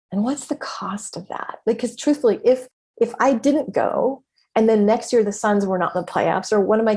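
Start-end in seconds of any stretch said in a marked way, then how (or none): 0.1-1.6 soft, voiceless, pointed whisper; whispered question. Real, not acted. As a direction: whisper it like a secret that matters. Rhetorical question after self-doubt list.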